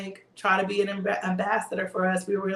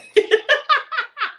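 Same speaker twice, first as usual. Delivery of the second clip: high squealing laugh; 7 dB louder than usual. Real, not acted. Laughing at own impression.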